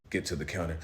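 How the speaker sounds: usual speaking voice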